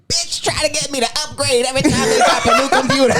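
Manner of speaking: high laughing rant; squeaky comic rant, laughing through it; Cat Williams impression. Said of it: "and he goes".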